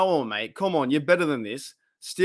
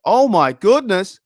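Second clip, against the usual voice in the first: loud, drawn-out gasp of disbelief; shocked exclamation; 5 semitones higher, 10 dB louder, slower than usual. Real, not acted. Hearing his mate had a fence fall on him, loud shocked exclamation. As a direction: react like you just heard something wild.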